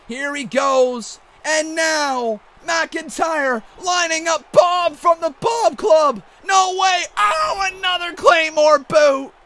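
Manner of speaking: excited wrestling commentator calling the action; hyped ringside play-by-play; play-by-play commentary. Acted. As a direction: call the match like a ringside announcer.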